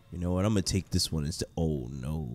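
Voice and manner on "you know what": ordinary talk, nothing marked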